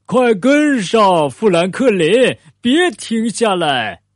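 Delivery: high, loud, drawn-out call; shouting encouragement. A narrator's reading. Bear urges fallen Franklin on, high drawn-out shout. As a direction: call back to a friend falling behind. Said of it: "他喊起来".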